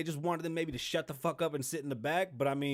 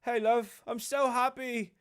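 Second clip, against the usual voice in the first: high-pitched, hammed-up happiness; mock a prince insisting he is delighted; put-on, over-cheerful prince voice; Harry impression; 6 semitones higher, 4 dB louder than usual. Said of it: "I'll be Harry".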